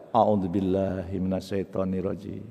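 slow, low, soft prayer; unhurried, hushed invocation; calm recitation. Acted. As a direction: keep reciting quietly under a scolding. Calm prayer while being scolded.

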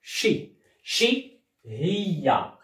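شي said as a soft sh sound, not tch; sound shi not chi. Shown as a demonstration. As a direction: she as شي, soft sh, no ch. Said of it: "ما كنطقهاش تشي، كنطقها شي".

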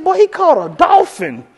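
wife inflating the story to her friend, high slow brag; bragging wife. Retold or imitated. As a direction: stretch the brag out wide-eyed. Wife exaggerates catch.

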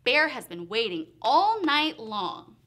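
ordinary delivery

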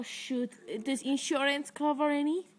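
ordinary talk, nothing marked

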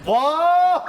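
loud high wow; thrilled gasp as the food lands; excited. Real, not acted. Food arrives at table. (신나서 지르는 탄성)

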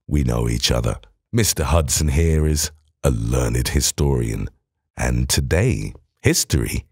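a low, composed Holmes; calm Holmes. Acted. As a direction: speak low and unhurried as Holmes. Holmes voiced low by narrator.

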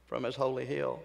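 soft, low drop; quiet, reverent verse ending; hushed close. Real, not acted. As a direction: let it fall away quietly after the shout. Hushed drop after shouted verse.

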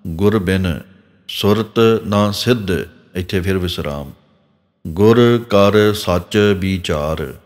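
ਗੁਰ ਬਿਨ, ਸੁਰਤ ਨਾ ਸਿੱਧ split apart, a pause after ਸਿੱਧ; slow careful phrasing, break at each ਵਿਸਰਾਮ; phrased with pauses. Shown as a demonstration. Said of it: "ਇਸ ਤਰ੍ਹਾਂ ਪਾਠ ਨਖੇੜ ਨਖੇੜ ਕੇ ਬੋਲਣੇ". (ਵਿਸਰਾਮ ਤੇ ਰੁਕ ਕੇ ਨਖੇੜ ਕੇ ਪਾਠ)

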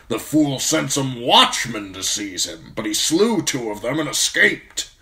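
put-on loud, high old officer, scornful; forceful old commander. A narrator's reading. Commander tells his steward news from the capital, loud and scornful. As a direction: lay out the news with impatient scorn. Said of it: "Mormont snorted".

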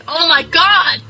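ordinary talk, nothing marked

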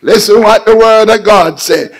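rising, driving sermon voice; full-force preaching. Real, not acted. Preacher exhorting his congregation at full force. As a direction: preach it with building fire.

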